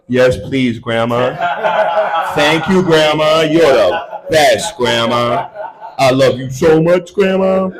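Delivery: slow, sugary thanks; hammed-up tender gratitude; sweet grandson. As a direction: melt into a good boy, drawn out. Sweetly accepting grandma's ravioli.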